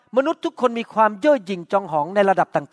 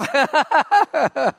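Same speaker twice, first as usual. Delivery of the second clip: high laugh; 6 semitones higher than usual. Real, not acted. Laughs after teasing.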